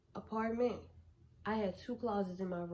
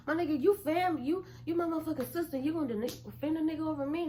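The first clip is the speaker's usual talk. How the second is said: higher, louder brother rant; mock angry brother; 7 semitones higher, 4 dB louder, faster than usual. Brother accusing his sister of taking sides, higher and louder.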